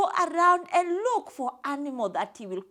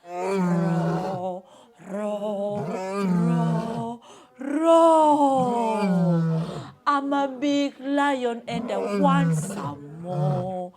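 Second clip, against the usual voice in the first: loud, drawn-out lion roar; big storybook lion, booming and stretched out; 5 semitones lower, 6 dB louder, much slower than usual. A narrator's reading. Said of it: "So Mister Lion was rolling".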